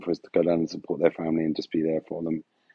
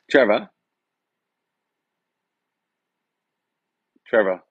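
louder calls of the name, asking; calling out; 8 dB louder than usual. Real, not acted. Streamer calling to his dead friend through the lampshade, calling out. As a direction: call the name into the room, half joking.

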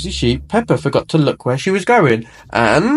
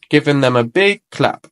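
ordinary delivery

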